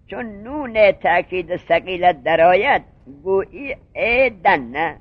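slow, emphatic teacher's recital with stretched syllables; deliberate, drawn-out classroom demonstration; slow grammatical recitation. Shown as a demonstration. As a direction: recite the rule slowly, drawing out ایدن نه. Demonstrates emphatic-suffix verb form.

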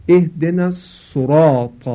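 الصراط said sorāt with plain s and u vowel; wrong sin with damma. Shown as a demonstration. Teacher imitating worshippers who over-thicken the sound.

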